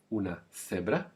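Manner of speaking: teacher models the th in cebra for learners to copy; Spanish th sound. Shown as a demonstration. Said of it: "th used as in".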